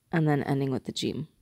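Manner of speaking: normal talking voice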